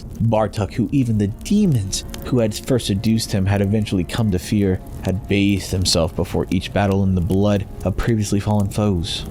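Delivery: ordinary delivery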